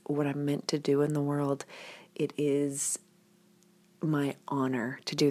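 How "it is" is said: ordinary talk, nothing marked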